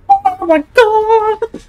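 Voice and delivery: very high, drawn-out loud cry; slow high-pitched wail of dread; panicked wail. Real, not acted. Player panicking in a hiding game, panicked wail.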